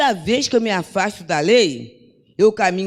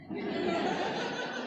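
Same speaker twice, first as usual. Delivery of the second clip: soft breathy chuckle; real, quiet laugh at one's own anecdote; amused laugh; 11 semitones higher, 14 dB quieter than usual. Real, not acted. Ri da resposta da tia. (riso baixinho e divertido)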